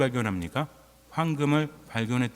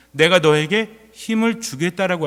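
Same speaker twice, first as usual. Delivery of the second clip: slow, much louder declaration; voice of God; 3 semitones higher, 7 dB louder than usual. Retold or imitated. God promising strength to the weary believer, slow and loud. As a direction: proclaim it slowly and firmly as God. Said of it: "하십니다".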